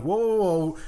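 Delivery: loud, high "whoa" to stop them; calling whoa. Retold or imitated. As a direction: rein someone in. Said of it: "I'm like".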